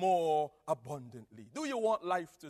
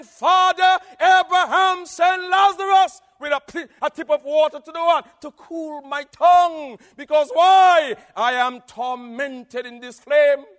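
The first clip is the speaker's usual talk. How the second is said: loud, high pleading cry; rich man in torment; 8 semitones higher, 13 dB louder than usual. Acted. Rich man crying to Father Abraham, loud pleading. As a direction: beg for water from the flames. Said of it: "He was saying".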